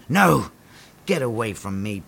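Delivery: ordinary delivery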